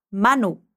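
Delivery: Manu with the first syllable MA stressed, then a falling tune. Shown as a demonstration. Teacher models the name for the student, MA stressed. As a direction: say Manu leaning on MA. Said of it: "A parte forte é o ma".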